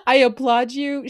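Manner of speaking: high, laughing mock praise; laughing applause. Laughing at viewers who find asking easy. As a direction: applaud them through a laugh.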